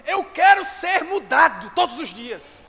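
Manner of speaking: loud, slow, pleading cry; shouted prayer. Acted. Believer praying at Jesus' feet, shouting the plea. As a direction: cry out the plea with full force.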